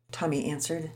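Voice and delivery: ordinary delivery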